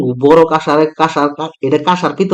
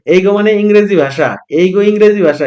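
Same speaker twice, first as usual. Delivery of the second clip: ordinary talk, nothing marked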